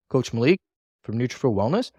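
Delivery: "Malik" and "Wellness" rising, assuming recognition; questioning intonation. Shown as a demonstration. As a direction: ask "Wellness" as if they should know. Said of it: "assume that they should already know".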